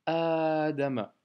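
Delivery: adama slow, the second A held; long A. Shown as a demonstration. Teacher rereading the word, slowly. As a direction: draw out adama. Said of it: "C'est un A prolongé".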